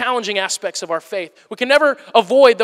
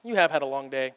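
soft and much lower, soothing the wife; tender reply; 5 semitones lower, 4 dB quieter than usual. Acted. Husband tenderly soothing his tired wife at bedtime, soft and low. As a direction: gently comfort a tired wife. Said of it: "I could say".

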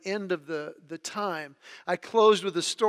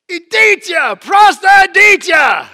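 shouted punchline; 11 semitones higher, 19 dB louder, slower than usual. Retold or imitated. Punchline shouted in Russian to leaders.

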